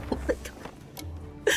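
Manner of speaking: breathless slow whisper of disbelief; a hushed, slow oh my God under the breath; whispered dismay. Retold or imitated. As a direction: breathe it out slowly, appalled. Reacting to overheard neighbors.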